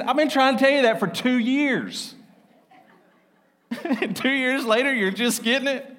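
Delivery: high-pitched incredulous mentor, laughing; imitating an exasperated, tickled mentor; retold amused exasperation. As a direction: tease him through laughter for finally catching on.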